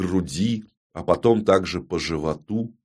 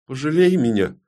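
high-pitched plea for mercy; beg, with the voice pitched up; pleading; 7 semitones higher than usual. Acted. Далина begs the queen for pity, pleading. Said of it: "неожиданно попросила Далина".

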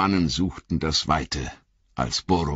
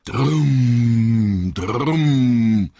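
booming drums; 5 semitones higher, 6 dB louder than usual. A narrator's reading. The drums boom again, loud and heavy. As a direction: make the drums roll out loud. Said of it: "dröhnten wieder die".

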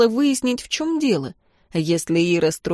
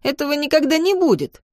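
high, outraged protest; indignant; 8 semitones higher than usual. A narrator's reading. Girl rejects Vadim's cheeky boast, indignant. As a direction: shoot up in pitch, scandalised. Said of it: "От такой наглости и самоуверенности у меня перехватило дыхание".

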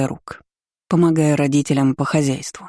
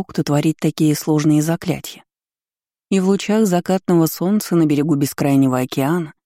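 plain reading voice

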